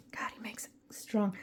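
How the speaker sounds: hushed whisper about the coffee. Real, not acted. Tasting husband's coffee, a hushed whisper. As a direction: whisper it like a secret aside.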